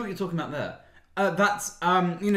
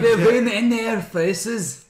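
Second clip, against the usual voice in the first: slow, loud, higher Scottish voice; slow thick Scottish groundskeeper; groundskeeper Willie; 5 semitones higher, 5 dB louder, much slower than usual. Retold or imitated. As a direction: drag it out in a thick Scottish brogue. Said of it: "his little bit of groundskeeper Willie was too good".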